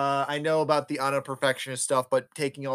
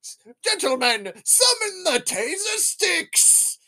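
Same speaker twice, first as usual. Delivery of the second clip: high-pitched theatrical villain taunt; hammed-up villain summoning henchmen, pitch soaring; Spider-Man; 11 semitones higher than usual. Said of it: "The Spider-Man's like".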